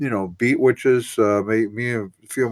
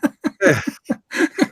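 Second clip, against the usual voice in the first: a real high laugh breaking out. Real, not acted. Guest laughing at host's joke, high real laugh.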